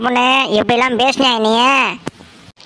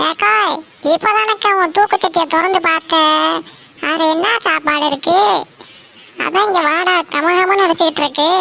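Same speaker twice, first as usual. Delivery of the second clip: put-on higher, wheedling beggar girl calling out; 4 semitones higher than usual. Beggar girl asks the woman about last night's curry, higher and wheedling. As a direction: coax the woman for leftover food.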